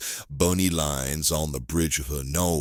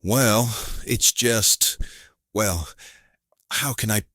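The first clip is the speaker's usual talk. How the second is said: halting, higher-pitched search for words; reluctant, stop-start and embarrassed; awkward hesitation; 6 semitones higher than usual. Acted. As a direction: fumble towards a delicate admission. Client struggles to describe his marriage.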